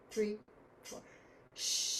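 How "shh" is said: sh hiss. Shown as a demonstration. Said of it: "doing a sh sound".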